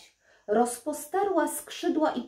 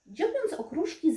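ordinary delivery, nothing marked

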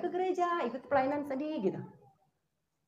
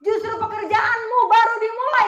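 God's words proclaimed; 11 semitones higher, 11 dB louder than usual. Retold or imitated. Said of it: "kata Tuhan".

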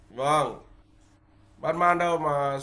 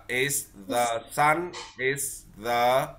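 slow model phrase; 3 semitones lower, slower than usual. Shown as a demonstration. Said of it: "con dùng là is the".

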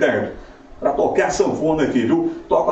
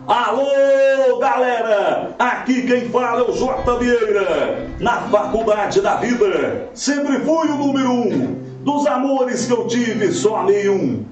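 hyped rodeo announcer; 3 semitones higher, slower than usual. Shown as a demonstration. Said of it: "Vamos lá, assim, ó".

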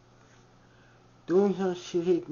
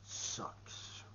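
a soft unvoiced whisper; whispered aside; 24 dB quieter than usual. Real, not acted. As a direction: breathe the word out under your breath. Aside after shouting the name.

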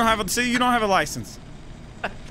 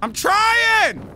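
yelled back in frustration; frustrated shout; 11 semitones higher, 4 dB louder than usual. Real, not acted. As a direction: shout it at your partner.

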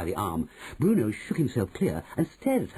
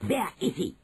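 high, sharp witch voice; higher-pitched, curt witch without shouting; Grand High Witch snapping; 8 semitones higher than usual. A narrator's reading. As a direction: snap it out, hunting prey. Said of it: "snapped the Grand High Witch".

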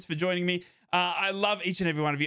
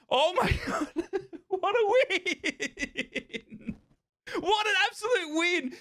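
laughing; 10 semitones higher, much slower than usual. Real, not acted. Joy after late winner.

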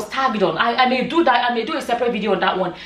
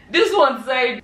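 usual speaking voice

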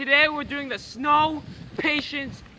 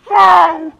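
sharp cold yelp; a loud yelp from the cold; pained yelp; 9 dB louder than usual. Real, not acted. Snow dumped on him.

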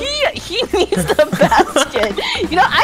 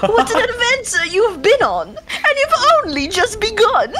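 high, hammed-up British accent; swooping mock-British dialogue reading; terrible British accent; 9 semitones higher than usual. Player reads game dialogue to a friend, badly faked British accent. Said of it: "My terrible British accent".

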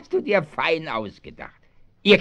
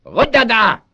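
shout; 7 semitones higher, 8 dB louder than usual. Acted. Thief catches hidden children.

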